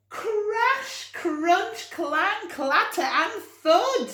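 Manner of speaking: very high and loud sound words; storyteller playing the crash; dramatic crash words. A narrator's reading. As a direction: hurl out each crash noise. Crash sound words read to children.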